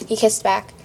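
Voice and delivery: ordinary delivery, nothing marked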